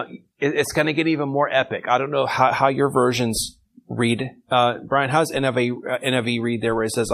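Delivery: ordinary talk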